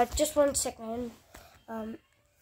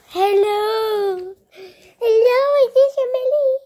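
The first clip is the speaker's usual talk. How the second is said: high, loud singsong hello; playful pet talk; 7 semitones higher, 5 dB louder than usual. Real, not acted. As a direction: call brightly up high to your dog. Playful calling to the dog.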